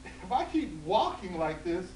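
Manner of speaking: put-on high, loud fussy old woman; hammed-up old woman fretting. Old woman voiced in told story.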